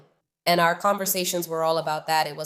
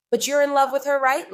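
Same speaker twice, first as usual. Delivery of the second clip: incredulous; 6 semitones higher, 6 dB louder than usual. Retold or imitated. Pressing friend about her crush.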